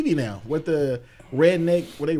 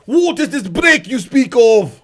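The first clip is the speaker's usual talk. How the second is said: loud, high, slow mock Scottish accent; 6 semitones higher, 12 dB louder, slower than usual. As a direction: belt it out in a bad Scottish accent. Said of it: "say it again".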